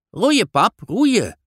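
put-on high, pushy little girl nagging her dad; a child egging her father on; impatient girl urging. Girl urges father to row. (hoog, ongeduldig meisjesstemmetje)

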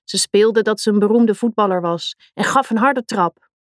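ordinary delivery, nothing marked

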